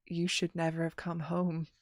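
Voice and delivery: a chilling threat instead of a welcome; ominous whisper. Retold or imitated. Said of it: "that whisper".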